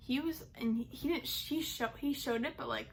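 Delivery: ordinary talk, nothing marked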